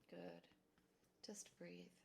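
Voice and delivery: soft whispered reminder to breathe; hushed cue to just breathe. Acted. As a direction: whisper it gently. Guiding a calming breath.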